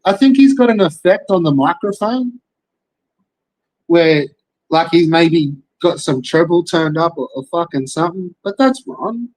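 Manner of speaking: normal talking voice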